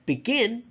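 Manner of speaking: beGIN, GIN pitched high; weak "Be", strong "gin" in "Begin"; "Begin" stressed at the end; stress on second syllable. Shown as a demonstration. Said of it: "the second syllable".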